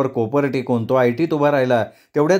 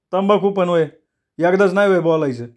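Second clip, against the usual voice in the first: high-pitched mild grumbling; put-upon shopkeeper's protest; exasperated grumble; 5 semitones higher than usual. A narrator's reading. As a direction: complain in a pitched-up voice. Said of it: "न राहून दादू पुटपुटला".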